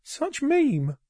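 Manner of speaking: very high Doge-speak; high, comic meme voice. Acted. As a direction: squeal it in silly meme talk.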